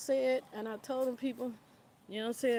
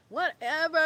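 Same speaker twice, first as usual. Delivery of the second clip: dismissive; 5 semitones higher, 6 dB louder than usual. Retold or imitated. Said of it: "and I'm like".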